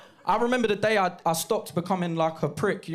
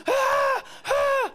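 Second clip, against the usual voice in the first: very high mock crowd scream. Whole assembly hall screaming at the star on stage. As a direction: squeal like a starstruck school crowd.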